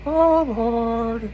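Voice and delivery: high, loud falsetto gasp of overwhelmed pleasure; Sam's comic moan. Retold or imitated. Sam under Gilly, overwhelmed, high loud falsetto moan. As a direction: squeak it out like a dazed virgin.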